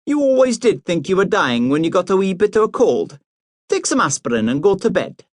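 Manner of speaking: high, brisk, unsympathetic mother; busy mother dismissing her son's cold, high and brisk; heartless busy mother. A narrator's reading. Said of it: "with all the heartlessness".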